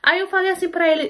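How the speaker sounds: usual speaking voice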